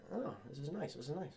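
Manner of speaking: hushed admiring. Acted. Predator quietly admires the gun Lexa returned to him. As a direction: murmur it like a treasured gift.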